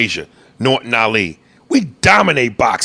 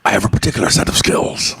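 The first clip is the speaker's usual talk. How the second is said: Liam Neeson; 2 semitones lower than usual. Retold or imitated. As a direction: whisper it like a threat. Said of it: "quote Liam Neeson from Taken".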